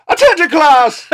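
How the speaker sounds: high, loud teacher announcement; strict teacher. Acted. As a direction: call out like a strict schoolteacher. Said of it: "you'd be like".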